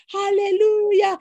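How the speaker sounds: mock hallelujah shout. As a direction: drag out a mock church shout.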